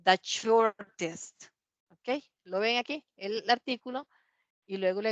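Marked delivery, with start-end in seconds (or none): 0.0-1.4 very slow model phrase. Shown as a demonstration. Teacher modeling the superlative for class, very slowly. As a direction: stretch every syllable very slowly.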